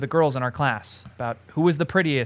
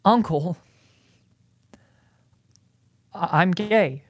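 upset; 4 semitones higher, slower than usual. Real, not acted. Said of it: "Why are you getting upset".

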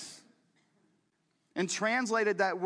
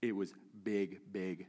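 deadpan understated; 12 semitones lower, 8 dB quieter, slower than usual. Retold or imitated. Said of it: "It's like".

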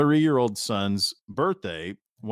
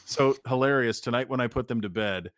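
ordinary talk, nothing marked

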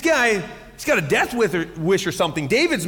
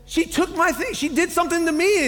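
put-on whiny kid; 10 semitones higher than usual. Son voiced defending himself.

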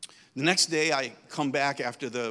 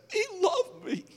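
high voice, half laughing, half crying; tearful laughing child. Retold or imitated. Boy answers mother through tears, high and laugh-crying. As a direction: break into a laugh-sob like a boy.

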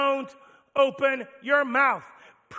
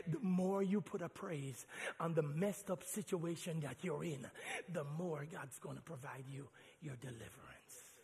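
soft, low, intimate exhortation; quiet preaching; 9 semitones lower, 15 dB quieter than usual. Real, not acted. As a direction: lean in and plead gently.

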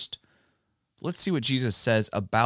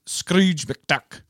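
put-on Scrooge McDuck's Scottish accent, higher and drawn out; Scottish accent impersonation; 8 semitones higher, 6 dB louder than usual. Host imitating a childhood cartoon for young co-host, Scottish accent. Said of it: "he had a Scottish accent".

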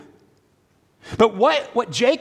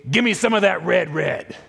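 a light caveman Esau, slightly deeper. Retold or imitated. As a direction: grunt out the demand like a simple brute. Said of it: "I don't know why he's got that deep a voice".

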